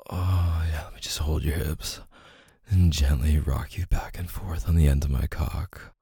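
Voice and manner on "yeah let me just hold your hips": breathy unvoiced whisper, close to the mic. Lover to partner in bed, whispered. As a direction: breathe it out without voice. Intimate roleplay, whispered line.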